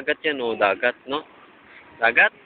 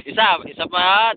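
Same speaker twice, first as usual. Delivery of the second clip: usual speaking voice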